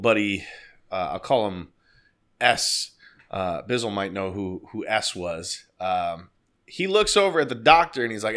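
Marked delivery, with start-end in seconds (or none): none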